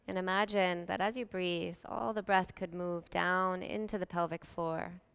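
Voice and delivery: calm, unhurried meditation voice with long pauses; soothing yoga nidra guidance, evenly paced; guided meditation. Real, not acted.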